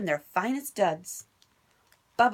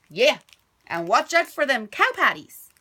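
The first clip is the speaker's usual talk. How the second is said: louder, higher bossy stepbrother; bossy order; 4 semitones higher, 8 dB louder than usual. Acted. Stepbrother Dwayne ordering Bubba about, loud and bossy. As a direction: boss him around from horseback. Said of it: "added Milton".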